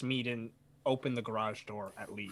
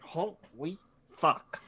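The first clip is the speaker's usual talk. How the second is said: drawn-out stunned oath; slow, reeling disbelief; retold dazed awe; 3 semitones higher, 3 dB quieter, much slower than usual. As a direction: stretch it out slowly, stunned. Retelling being dazed by fumes.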